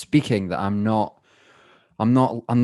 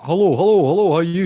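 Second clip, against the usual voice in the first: higher, louder repeated hellos; put-on phone voice; 8 semitones higher, 6 dB louder, faster than usual. Friend phoning Chris, put-on phone voice. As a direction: pitch it up for a phone greeting. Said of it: "I'll speak like that".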